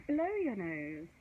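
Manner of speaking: ordinary delivery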